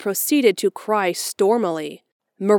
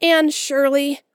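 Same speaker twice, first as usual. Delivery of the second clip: faltering; 7 semitones higher, 3 dB louder, slower than usual. A narrator's reading. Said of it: "reluctantly faltered forth".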